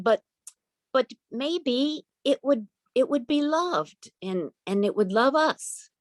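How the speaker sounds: put-on high, slow, gentle child voice; hopeful little girl. Girl tells her mother the dog would love them, slow and high.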